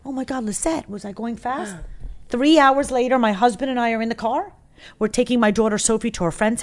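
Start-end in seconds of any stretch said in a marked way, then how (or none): 0.1-1.8 soft, worried question to herself; hushed, a bit alarmed; Siggy quietly asks her housekeeper, softer; worried murmur. Retold or imitated. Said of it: "And I'm like".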